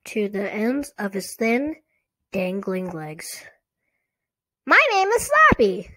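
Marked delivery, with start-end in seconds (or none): 4.7-5.9 high, loud, squeaky ventriloquist dummy. A narrator's reading. Girl makes her dummy introduce himself, squeaky dummy voice. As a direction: squeak it out like a puppet. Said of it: "Slappy said in Lindy's squeaky voice".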